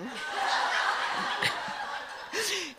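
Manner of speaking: airy high burst of laughter; a short, high, breathy laugh; high embarrassed laugh. Real, not acted. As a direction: laugh at your own silliness. Laughs at dessert confession.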